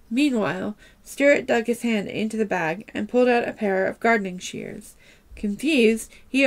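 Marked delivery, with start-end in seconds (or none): none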